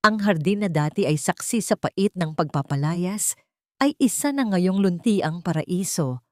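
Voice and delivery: usual speaking voice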